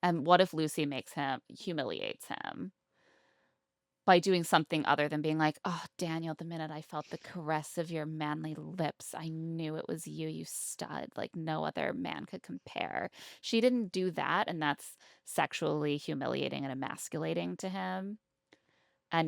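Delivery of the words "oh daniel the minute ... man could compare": softer, mock-dreamy gushing over a lover; hammed-up lovestruck swoon to Daniel. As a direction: gush like a smitten heroine, half joking.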